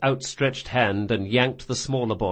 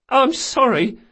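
high, loud tearful voice; sobbed apology; 13 semitones higher, 5 dB louder than usual. Acted. Boy to old woman, high sobbing apology. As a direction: plead through put-on sobs. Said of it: "Locke sobbed".

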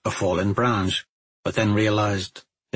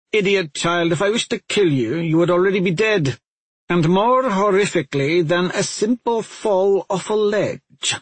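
higher, contemptuous scolding witch; scornful scolding; 8 semitones higher than usual. A narrator's reading. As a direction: sneer down at the foolish child. Witch scolds hesitant girl.

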